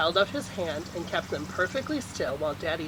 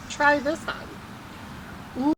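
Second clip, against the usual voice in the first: mama coaxing; 8 semitones higher, much slower than usual. A narrator's reading. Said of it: "she said to Casey".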